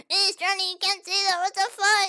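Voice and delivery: high, loud mock scolding. Streamer plays a scandalised voice scolding her own joke, high. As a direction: scold like a shocked prude.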